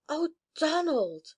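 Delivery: tearful joy. A narrator's reading. Reunion with lost brother.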